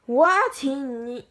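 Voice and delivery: usual speaking voice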